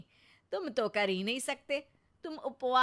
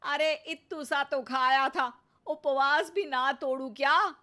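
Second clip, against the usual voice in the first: indignant protest; 5 semitones higher, 7 dB louder than usual. Acted. Sulking wife scolded over eating.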